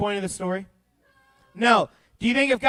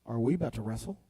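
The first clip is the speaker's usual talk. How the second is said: much lower and softer, sleepy question; drowsy Jacob; 13 semitones lower, 11 dB quieter, much faster than usual. Acted. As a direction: ask lazily, half awake. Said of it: "Jacob looks up and goes".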